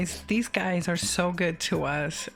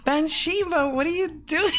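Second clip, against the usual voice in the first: much higher, mock scolding; high, fond what are you doing; touched disbelief; 10 semitones higher than usual. Real, not acted. Playful scolding of generous donor.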